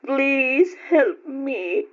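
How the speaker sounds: slow, broken, tearful begging; aunt begging her nephew on the phone, crying; tearful plea. Acted. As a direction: beg through the tears. Crying aunt begs nephew on call.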